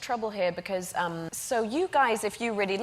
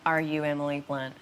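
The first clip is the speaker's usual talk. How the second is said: put-on Southern accent; 6 semitones lower than usual. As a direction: imitate a Southerner sizing someone up. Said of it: "a little bit Southerny".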